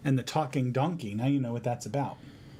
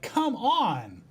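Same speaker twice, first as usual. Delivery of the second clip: exasperated Balak; 8 semitones higher than usual. Acted. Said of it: "And Balak is like".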